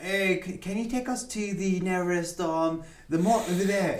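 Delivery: hammed-up British accent, asking for directions. Husband asking a stranger abroad for directions, in a fake British accent. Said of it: "my British accent is so weird".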